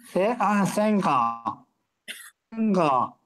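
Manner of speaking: holding tongue with fingers. Shown as a demonstration. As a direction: speak with the tongue pinched. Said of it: "Hold your tongue and say".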